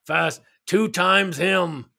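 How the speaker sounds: very slow, gruff put-on grumble; grouchy viewer. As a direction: mutter it out like a cranky old man. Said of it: "grouchy viewer".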